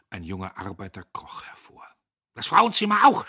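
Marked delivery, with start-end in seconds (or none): 2.3-3.3 very high, loud outrage; angry high-pitched shout; shouted order. A narrator's reading. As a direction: yell the order indignantly. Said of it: "schrie Diederich".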